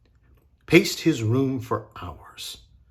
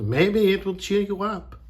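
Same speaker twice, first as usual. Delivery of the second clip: higher-pitched coaxing; lightly voiced mother, pitched up; encouraging mother; 8 semitones higher, 4 dB louder than usual. A narrator's reading. Mother hopes to cheer Mirette, encouraging. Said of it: "her mother said".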